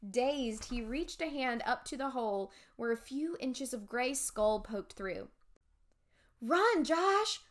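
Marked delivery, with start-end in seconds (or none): 6.4-7.4 high, loud urgent cry. A narrator's reading. Amanda to Josh as Dawes is hit, crying out. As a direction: yell a warning to flee. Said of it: "I cried".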